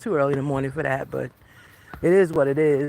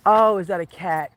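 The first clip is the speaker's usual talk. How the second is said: ordinary talk, nothing marked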